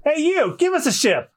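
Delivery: high, eager call; very high-voiced young captain hailing someone; Luffy. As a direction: pitch it way up and demand it. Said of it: "Oh, no, that's Luffy".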